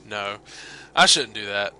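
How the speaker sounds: ordinary delivery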